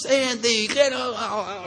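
high-pitched calling out at normal volume; mock yell. Senator hails a friend across the street, mock yell. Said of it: "and he yells".

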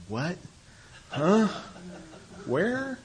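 confused. Retold or imitated. Newly sober man acting out his own confusion, high bewildered yelps. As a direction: play him lost and baffled, glancing around.

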